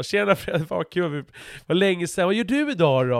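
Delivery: put-on high, bright, friendly hello to an old pal; cheerful greeting. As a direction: greet him like a long-lost buddy. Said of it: "och så sitter jag och fortsätter prata med dig". (glatt och högt hälsande på Fredde)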